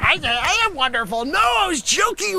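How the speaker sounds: high, loud protest to Cubes; exasperated shout. Real, not acted. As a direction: shout up high, pleading and outraged. Pleading with Cubes over joke.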